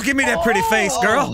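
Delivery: loud, relishing mock menace; gloating shout. Killer player to victim, gloating shout. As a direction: crow loudly over your caught victim.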